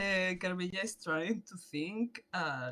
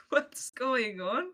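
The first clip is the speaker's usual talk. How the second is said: slow, raised question of disbelief; giddy, higher-pitched bafflement; bewildered amusement; 6 semitones higher, 5 dB louder, much slower than usual. Acted. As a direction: stretch it out, baffled and tickled. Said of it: "that is like".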